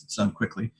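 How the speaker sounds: ordinary delivery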